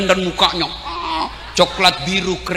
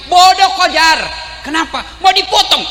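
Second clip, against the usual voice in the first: much higher, louder taunting yell; mock shout; 7 semitones higher, 4 dB louder than usual. The devil taunts Hajar about her son, loud mocking shout. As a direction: bark the taunt up high.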